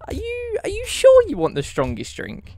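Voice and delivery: barmaid asks, squeaking up on the first word; lightly voiced barmaid checking he's sure; doubtful barmaid. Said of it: "she goes".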